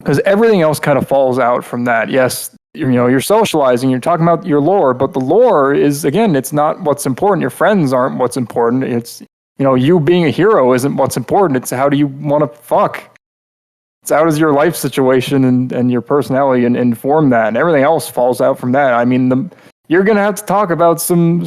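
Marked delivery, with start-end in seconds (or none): none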